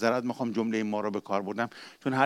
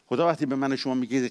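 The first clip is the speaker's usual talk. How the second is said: usual speaking voice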